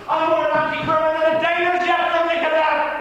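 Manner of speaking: loud, high, defiant outburst; defiant cry. Acted. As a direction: fling it out defiantly. Horatio seizes the poison cup.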